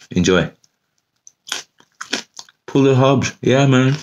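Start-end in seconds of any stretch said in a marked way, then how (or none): 2.7-3.9 high, pumped-up cheer; excited. Real, not acted. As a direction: hype yourself up. Cheering after eating chili.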